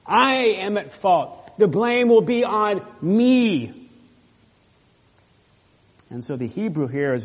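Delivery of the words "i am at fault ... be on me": higher, louder, slow and deliberate; Judah taking blame. Acted. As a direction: take the blame, slowly and firmly. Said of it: "looking over at Reuben like".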